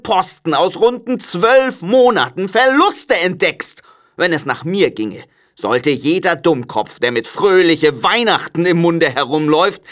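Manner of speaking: crotchety uncle building in outrage; grumpy Scrooge, ranting. A narrator's reading. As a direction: work up into a cranky rant.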